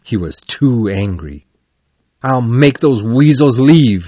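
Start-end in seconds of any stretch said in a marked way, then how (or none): 2.2-4.1 mildly raised, indignant narrated shout. A narrator's reading. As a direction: declare it angrily, short of a real shout. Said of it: "he shouted".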